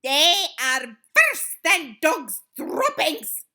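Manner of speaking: slow, high, loud witch screech. A narrator's reading. Grand High Witch topping her insult, screeched. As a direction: screech it out slowly. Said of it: "screeched the Grand High Witch".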